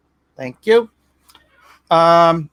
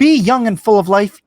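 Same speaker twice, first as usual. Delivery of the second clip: enthusiastic retort; 6 semitones higher, 6 dB louder than usual. Acted. Rebuttal to Eden.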